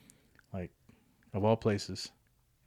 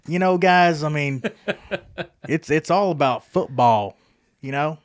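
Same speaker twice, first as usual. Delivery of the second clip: higher and much louder, slow country twang; country drawl imitation; 7 semitones higher, 11 dB louder, slower than usual. Imitating a country football star talking to the guys in a hammed-up drawl. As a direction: ham up a rural Southern football player. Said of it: "He's so country".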